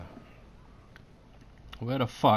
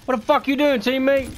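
high, loud outraged yell at a teammate; real disbelief, shouted; 10 semitones higher, 4 dB louder than usual. Real, not acted. Player yelling at a teammate who killed him, outraged.

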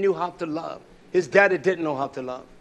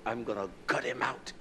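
quiet retold threat; 6 semitones lower, 12 dB quieter than usual. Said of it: "I said it that way".

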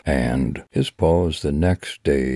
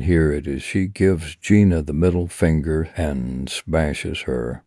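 ordinary delivery, nothing marked

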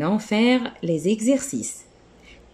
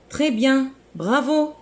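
high, cheerful teacher praise; bright praise; 8 semitones higher than usual. Real, not acted. (félicitations enjouées)

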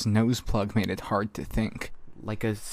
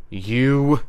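higher, loud outburst; loud retort; 4 semitones higher, 8 dB louder than usual. A narrator's reading. Bristow starting to respond to Verona, loud retort. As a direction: start a forceful comeback.

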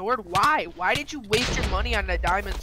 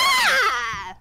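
startled shout; 5 dB louder than usual. Real, not acted. Iron golem attacking.

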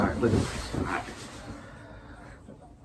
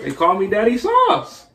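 higher, rising at the end; exasperated repeat; 7 semitones higher than usual. Real, not acted. Player gives away name after failed guesses, exasperated. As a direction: blurt it out in one go.

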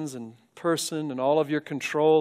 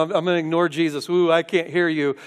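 fast, taunting voice of a scoffer; mock unbeliever; 3 semitones higher, 6 dB louder than usual. Preacher mocking a scoffer, fast and taunting. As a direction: lampoon someone ignoring God.

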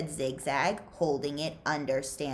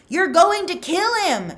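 loud and much higher, alarmed; shouted; 14 semitones higher, 10 dB louder than usual. Acted. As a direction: shout in panic at the choking. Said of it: "shouted Mister Pendanski".

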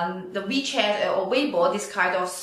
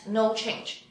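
ordinary talk

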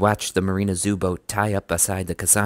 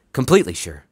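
firm; 5 semitones higher, 5 dB louder than usual. Acted. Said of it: "replied firmly".

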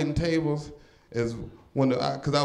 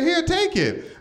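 much higher, meek scared woman; high frightened lady voice; 13 semitones higher, slower than usual. Retold or imitated. The robbed lady giving up her purse, squeaky and meek. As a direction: hand it over in a tiny high voice.

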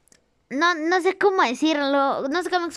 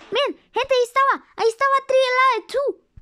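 high, hurried excited discovery; excited pointing out; 6 semitones higher, 4 dB louder, faster than usual. Real, not acted. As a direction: urge everyone to look, pitched up. Excited spotting of a character.

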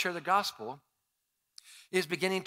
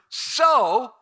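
"so" hit higher and louder; stressed word; 9 semitones higher, 12 dB louder than usual. Shown as a demonstration. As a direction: punch the word "so". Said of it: "The very next word is the word".

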